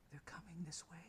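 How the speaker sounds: hushed, voiceless whisper; whispering young boy. Acted. Game master voices a young boy heard in the cave, whispering. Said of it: "the whispering of a young boy".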